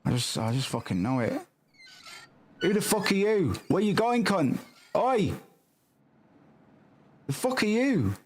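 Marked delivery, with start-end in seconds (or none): 2.6-8.1 mock angry yell. Streamer playing shopkeeper yells at an intruding customer, mock angry. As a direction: bark at the stranger in the shop, half joking.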